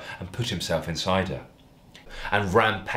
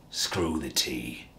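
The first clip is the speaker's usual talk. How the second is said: lower, softer, slow menacing growl; mock roar; 7 semitones lower, 6 dB quieter, much slower than usual. A narrator's reading. Darren rejecting the tea for sex, low slow growl. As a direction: lean in low and slow like a brute. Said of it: "roared Darren".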